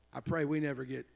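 ordinary talk, nothing marked

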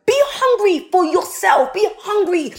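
loud, high, pleading exhortation that keeps climbing; fiery preaching. Acted. Motivational speaker urging young listeners to be hungry, fiery preaching. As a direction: preach it at full force, begging them.

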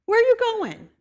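high, urgent whoa calling herself back; alarmed self-rebuke. Retold or imitated. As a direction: pull yourself back in alarm. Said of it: "And I'm like".

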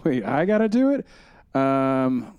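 normal talking voice

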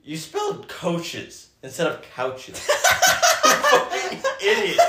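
high-pitched laughing mock insults. Real, not acted. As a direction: cackle through the insults. Mock insulting friend while laughing.

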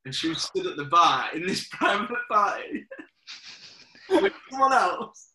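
laughing through it. Real, not acted. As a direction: try to finish the line but crack up. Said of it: "I don't know if I'm gonna be able to say this without laughing".

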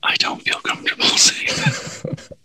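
soft whisper with a laugh. Acted. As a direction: whisper it back teasingly. Whispered mocking echo.

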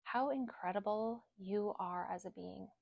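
soft, unhurried meditation voice with long pauses; guided affirmations. Acted. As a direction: lead them gently through each affirmation. Leads spoken affirmations.

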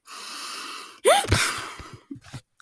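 high, loud shocked gasp; sharp gasp. Real, not acted. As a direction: gasp in outrage. Sudden outrage over a question.

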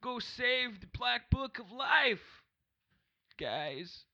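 rally the group like a folksy president; party leader in a Clinton drawl, calling out slowly; Bill Clinton voice. Acted. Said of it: "You're Bill Clinton in the desert".

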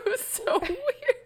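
high laughing teasing. Real, not acted. Teasing her friend about the third person, laughing.

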